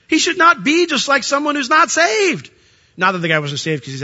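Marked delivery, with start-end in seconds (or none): 0.1-2.5 high, loud emphatic rebuke; insistent pulpit correction; forceful preaching. Real, not acted. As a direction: preach it hard, pitched up.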